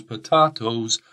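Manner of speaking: "potatoes" as puh-TAH-toes; joke British pronunciation. Shown as a demonstration. Teacher quoting the song, "potatoes" with TAH. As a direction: say "potatoes" with a long AH. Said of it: "we say potatoes".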